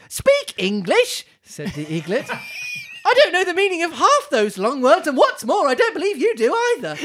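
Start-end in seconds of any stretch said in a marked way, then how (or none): 0.1-1.2 put-on slow, higher, keen young eaglet; eager eaglet. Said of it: "make him, like, ultra keen". 3.0-7.0 put-on much higher, louder, excited young bird; ultra keen eaglet. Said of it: "make him, like, ultra keen".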